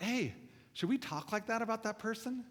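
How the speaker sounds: high, soft questioning dad voice; gentle parental correction. Retold or imitated. Dad gently correcting his kids.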